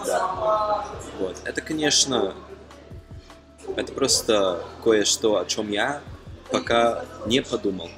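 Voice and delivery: ordinary delivery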